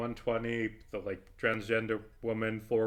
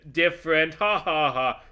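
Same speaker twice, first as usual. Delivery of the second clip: louder, higher mock-amused tone; sarcastic mockery; 6 semitones higher, 8 dB louder than usual. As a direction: play dumb to mock the joke. Sarcastic parody of the joke.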